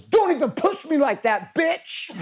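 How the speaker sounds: put-on high, slow, sassy toddler snapping "bitch"; toddler telling off her sister. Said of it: "Isla's out of nowhere and goes".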